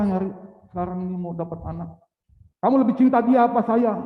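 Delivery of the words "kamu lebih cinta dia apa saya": loud, fast demanding question; challenging voice. Acted. Allah testing Ibrahim's love for his son, loud and demanding. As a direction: press the question hard and loud.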